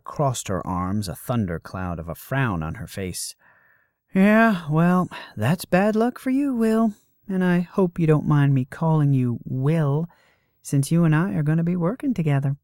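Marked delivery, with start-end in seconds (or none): 4.1-12.6 high, loud, breezy woman; brash muse voice. Acted. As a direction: play a cocky young goddess. Voices Calidora in the story.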